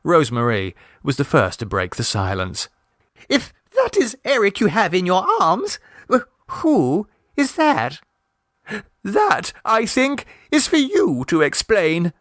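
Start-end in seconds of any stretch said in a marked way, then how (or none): 3.3-8.0 put-on high, halting young woman's question; bewildered. A narrator's reading. Rosemarie, baffled and stammering. 9.0-12.1 high, cold stiff reply; stiffly. A narrator's reading. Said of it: "said Missus Mummaby stiffly".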